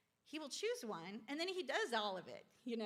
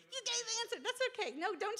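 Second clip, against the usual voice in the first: laughing; 12 semitones higher, 6 dB louder, much faster than usual. Real, not acted. Laughing at workshop participant.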